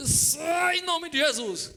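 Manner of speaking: very slow, high, loud shouted rebuke; desperate shout. Retold or imitated. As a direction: blast it out while being choked. Choked by tie, casting out.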